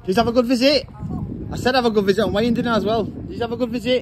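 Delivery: high, loud mock Leeds accent. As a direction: parrot the visitor greeting in a squeaky Leeds accent. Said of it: "Leeds accent".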